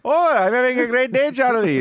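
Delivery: high, loud mock Indian accent; put-on Indian accent. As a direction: greet the agent in an over-the-top Indian accent. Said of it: "if you put on an Indian accent".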